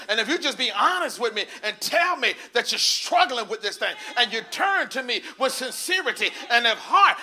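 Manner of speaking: loud, high, driving sermon delivery; full-force preaching. Real, not acted. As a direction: preach it hard, punching out each phrase.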